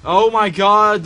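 loud, high, slow cry; alarmed cry. Real, not acted. Player finding the doors boarded up, alarmed cry. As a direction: cry out in dismay.

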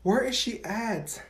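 ordinary talk

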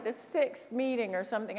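normal talking voice

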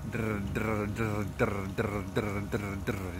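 drr with the tongue fluttering behind the teeth; rolled drr exercise; trill drill. Shown as a demonstration. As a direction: buzz drr with voice and air. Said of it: "add some voice to it and some air".

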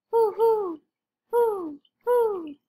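high, loud owl hoots; owl hooting. Acted. Mister Owl greets Quincy with high hoots. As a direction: hoo like a friendly desert owl.

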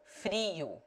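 "Frio" with a long ee sound. Shown as a demonstration. Tutor demonstrates the long i. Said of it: "E in bee".

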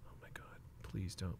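breathless whisper, then a soft plea. Real, not acted. Collector pleading with a stuck card, whispering. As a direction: barely voice it, dreading the worst. Fearing the card will tear.

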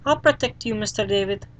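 put-on a small boy agreeing happily; eager little boy. Fluffy agrees to go with Mister David, kid voice.

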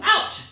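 high yelped ouch; playful pained yelp; mock ouch. Child hitting him.

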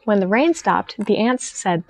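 plain reading voice

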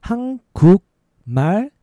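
three drawn-out, separate syllables; slow demonstration. Shown as a demonstration. Teacher showing learners the word for Korean, slow. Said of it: "Faster is".

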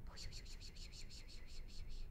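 breathless repeated whisper; desperate whispered refusal. Acted. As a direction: hiss the denials under your breath. Frantic whispered denial.

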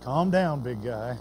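low, soft, gentle soothing; Jesus calming Peter, low and quiet. Retold or imitated. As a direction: settle a hothead down gently. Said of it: "the Lord just kinda looked at him".

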